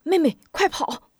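urgent cry. Acted. A boy warns his little sister as soldiers close in, urgent cry. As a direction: yell for her to run. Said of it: "忙喊".

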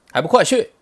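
loud, high dismissive command; impatient order. A narrator's reading. Sun Wukong sends little demons to their king, shouting impatiently. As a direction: send them running with one shout.

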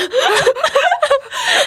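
high falling laugh; long high laugh. Real, not acted. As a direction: let the laugh roll out.

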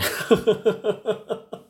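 high bright laugh. Real, not acted. Recalling the awkward customer, high laugh. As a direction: laugh at the memory.

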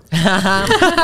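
short real laugh. Real, not acted. Guest laughing at the host's ad, real laugh. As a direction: burst out laughing at the joke.

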